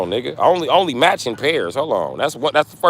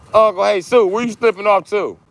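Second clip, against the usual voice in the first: calling out; 10 semitones higher, 5 dB louder than usual. Real, not acted. Calling out to someone.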